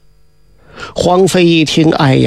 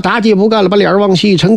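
ordinary delivery, nothing marked

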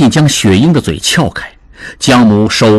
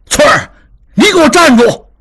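much higher, shouted order; loud call. A narrator's reading. Suspicious father shouts after fleeing maid. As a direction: yell after her to stop running. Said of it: "大喊一声".

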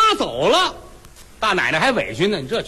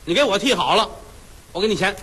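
plain reading voice

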